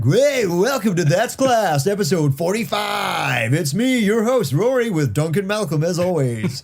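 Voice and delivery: stupidly intense radio host intro; high, slow, stretched-out DJ shout; over-the-top hyped radio announcer opening. Acted. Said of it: "a radio voice".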